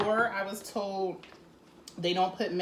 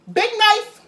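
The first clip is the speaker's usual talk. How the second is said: excited; 12 semitones higher, 13 dB louder than usual. Real, not acted. Excited reaction to big knife.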